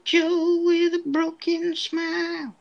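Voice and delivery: slow, high showman voice; slow high announcer tease. Pretend. Announcer-style intro bit.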